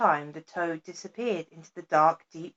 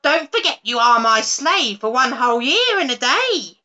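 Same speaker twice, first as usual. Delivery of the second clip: louder, higher reminder; crying out; 5 semitones higher, 7 dB louder than usual. A narrator's reading. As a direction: call after the demon as the witch. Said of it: "cried the witch".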